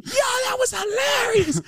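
high, slow cackling squeal; laughing inner voice. Retold or imitated. Inner voice cracking up, high and laughing. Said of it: "the thing in me is like".

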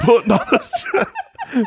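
laughing attempt at an Australian accent. Co-host asked for an Australian, laughing Australian accent. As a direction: start an Aussie barbecue line, cracking up. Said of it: "give me an Australian".

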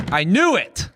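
high, triumphant "called it" exclamation; mock vindicated cry. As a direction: cry out as if proven right. Imagined reaction to seeing the list.

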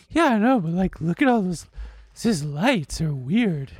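high, airy put-on voice of a bored date; imitating his date's voice. Guy imitating his dinner date at a Mexican restaurant, high mocking voice. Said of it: "Not only is this exactly how she sounds".

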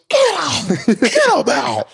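very high imitated coach's yell. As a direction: banish the player with a mock shout. Acts out coach benching him.

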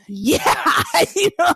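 bursting out laughing mid-word; laughing fit breaking through the story. Real, not acted.

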